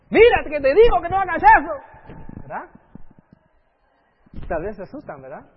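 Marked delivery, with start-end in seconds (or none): 0.1-2.4 higher and louder, yelling the warning; angry parental shout. Retold or imitated. Parent shouting at a child who won't listen, angry yell. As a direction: lose patience and shout at the child.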